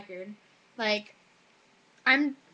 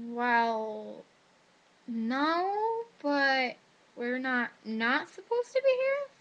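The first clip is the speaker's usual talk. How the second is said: sheepish hedging; much slower than usual. Retold or imitated. Students answer the officer who caught them, sheepish and hedging. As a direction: stretch it out, tentatively testing the excuse.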